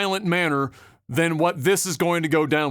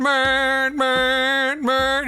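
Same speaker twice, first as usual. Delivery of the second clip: high, drawn-out mocking man, man; higher-pitched mock trucker voice; mock trucker droning; 7 semitones higher, much slower than usual. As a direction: imitate a dopey trucker moaning to himself. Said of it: "he's just sitting there going".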